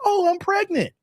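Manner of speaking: high, airy fake surprise; mock innocent pregnant announcement; mock surprised woman. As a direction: play the clueless girl. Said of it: "it's like".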